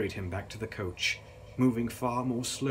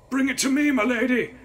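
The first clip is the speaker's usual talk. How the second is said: strained, high, loud croak of a man in pain; croaking injured man; 10 dB louder than usual. A narrator's reading. Injured coachman asks for gun.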